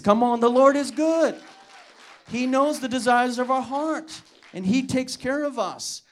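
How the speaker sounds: exultant preacher's shout; preaching at full force. Real, not acted. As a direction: lift the room with joy.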